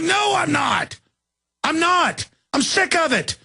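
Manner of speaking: high-pitched, insistent near-shout; retold exasperated refusal. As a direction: re-enact defying a cop, pitch soaring. Acted quarrel with a cop.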